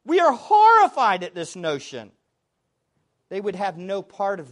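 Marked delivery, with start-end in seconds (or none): 0.0-2.1 loud, emphatic mock protest; raised voice, acting out an indignant objection; imitated outcry. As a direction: cry out in horrified objection, imitating. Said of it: "their actions screamed in effect".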